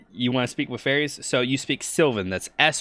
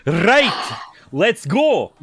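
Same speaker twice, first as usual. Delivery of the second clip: loud, high exaggerated Scottish shout; bad Scottish accent; 6 semitones higher, 6 dB louder, much slower than usual. Shown as a demonstration. Player showing off the worst Scottish accent, loud and hammed-up Scottish. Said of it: "pull off the worst Scot".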